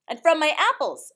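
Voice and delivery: put-on high, loud kid chiming in; eager child. A narrator's reading. Jack telling mother about his apples, loud and high. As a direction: pipe up like a proud little boy.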